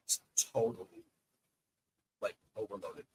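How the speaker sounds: muffled and distant, off the mic; talking away from the microphone; fuzzy soft voice. Real, not acted. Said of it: "you sound very fuzzy right now".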